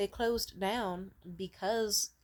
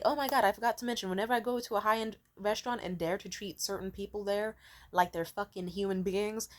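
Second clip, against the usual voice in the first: ordinary delivery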